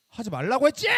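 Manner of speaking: acting out a loud, fed-up yell; retold angry outburst. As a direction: burst out as if pushed too far. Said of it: "내가 감정적으로 엄청 쏟아내는". (참다 터진 듯 화내며 외치는 재연)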